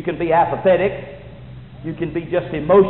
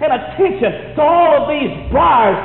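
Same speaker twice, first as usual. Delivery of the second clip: loud, high-pitched revival preaching; fiery preaching; 9 semitones higher, 4 dB louder than usual. Acted. Preacher pleading with the church at full force. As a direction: drive it home like a revival preacher.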